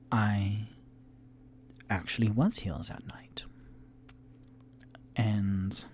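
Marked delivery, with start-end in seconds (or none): 0.1-3.4 tiefling woman whispering to the heroes; confiding whisper. Acted. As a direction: let her in on a secret. Tiefling NPC voice.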